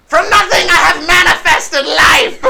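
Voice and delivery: high, loud, grandiose proclamation; awestruck kobold. Acted. Kobold seeing a child appear at his feet, loud awed proclamation. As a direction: cry out in high-pitched amazement.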